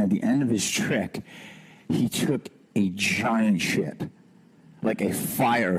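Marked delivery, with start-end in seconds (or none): none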